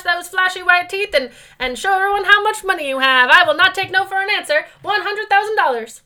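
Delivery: high, loud, pushy car dealer; oily salesman steamrolling the buyer; sleazy salesman. Acted. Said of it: "Do you like my used car salesman voice?".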